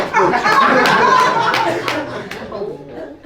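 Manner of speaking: a burst of high laughter; laughing at his own punchline. Real, not acted.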